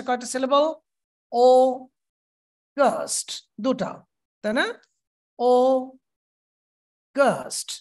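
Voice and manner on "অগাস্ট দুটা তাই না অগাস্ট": অগাস্ট stretched slowly into two syllables; drawn out অগাস্ট, beat by beat; syllable-split demo. Shown as a demonstration. Teacher claps অগাস্ট syllables with the class. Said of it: "হাত জোর করে ভাগ করেন".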